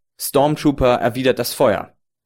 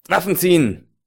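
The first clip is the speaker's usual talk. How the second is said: shouted order; 6 semitones higher than usual. Acted. Players' bluff fails, call to arms.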